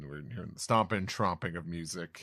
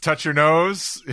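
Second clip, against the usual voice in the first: louder, higher, slow command; cop ordering; 9 semitones higher, 14 dB louder, slower than usual. Acted. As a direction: bark the sobriety test order. Joke police sobriety test.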